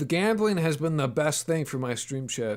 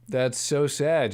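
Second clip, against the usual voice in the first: ordinary delivery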